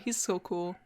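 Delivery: normal talking voice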